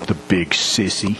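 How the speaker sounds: a hint of a trembling small boy; lightly voiced little boy, a touch shaky. Little boy left alone in the storm, lightly shaky child voice. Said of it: "the shaky voice of a little boy".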